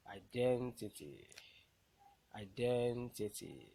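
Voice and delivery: Identity with DEN loud and long; teacher's demo of i-DEN-tity; stressed syllable. Shown as a demonstration. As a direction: slowly stretch DEN in Identity. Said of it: "the one that will be pronounced louder".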